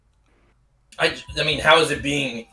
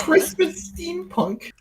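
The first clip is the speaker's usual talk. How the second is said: incredulous squeak; 4 dB quieter, much slower than usual. Retold or imitated.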